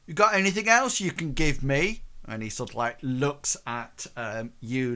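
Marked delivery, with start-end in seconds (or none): 0.0-2.0 higher, louder pushy ask; greedy demand. Acted. Thug pressing Leonard for more gifts, pushy and loud.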